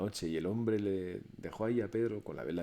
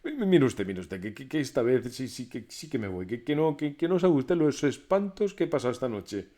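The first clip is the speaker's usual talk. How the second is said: stuttering, louder and higher with fright; frightened stammer; 4 semitones higher, 8 dB louder than usual. A narrator's reading. Pedro stammers his fright to the master. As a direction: blurt it out shaking. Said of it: "le dijo nada más llegar".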